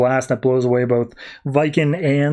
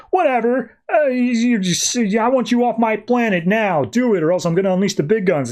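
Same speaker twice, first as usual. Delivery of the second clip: higher, rushing hothead threat; mock angry threat; 8 semitones higher than usual. Guy to Viking, ordering him off the planet in a mock angry threat. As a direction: bark the ultimatum like a cornered hothead.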